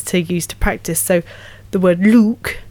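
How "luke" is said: LUKE said with a long Geordie oo; Geordie vowel. Shown as a demonstration. Actor showing a voice coach's practice word, Geordie vowel on LUKE. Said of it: "need to turn Geordie".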